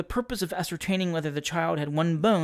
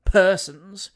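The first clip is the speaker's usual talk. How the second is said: defiant reply; 2 semitones higher, 6 dB louder than usual. A narrator's reading. Rival firmly repeats the insult.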